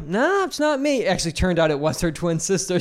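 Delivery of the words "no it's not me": high, drawn-out imitated denial; mock denial. Girl denying it is her on camera, mock denial. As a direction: stretch a high-pitched fake denial. Acted denial by accused woman.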